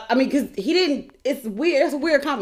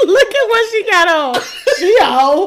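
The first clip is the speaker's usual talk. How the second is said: laughing quote; 9 semitones higher, 7 dB louder than usual. Real, not acted. Co-host repeats quote laughing.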